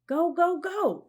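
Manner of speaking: high, loud go go go; urging. A narrator's reading. Annie urges the sinking sub toward the reef, high excited urging. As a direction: urge the sub on.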